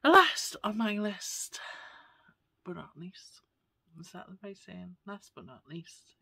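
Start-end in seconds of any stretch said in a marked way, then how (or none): 2.6-6.2 soft unvoiced whisper. Real, not acted. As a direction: whisper it like a private aside. Whispered aside about the saying.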